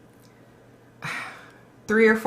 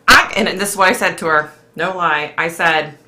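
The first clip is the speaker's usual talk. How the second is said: usual speaking voice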